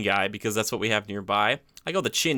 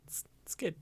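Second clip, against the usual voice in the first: whisper; 7 semitones higher, 14 dB quieter than usual. Real, not acted. Hushed verdict on McDonald's.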